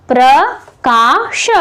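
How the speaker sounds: प्रकाश broken slowly as प्र, का, श; split into syllables. Shown as a demonstration. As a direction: draw out each part of प्रकाश with gaps between. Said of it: "इस तरह से हम इसको तोड़ सकते हैं". (प्रकाश को तोड़कर धीरे-धीरे: प्र, का, श)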